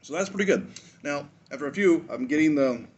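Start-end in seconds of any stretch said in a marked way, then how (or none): none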